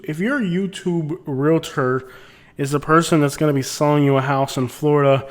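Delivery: usual speaking voice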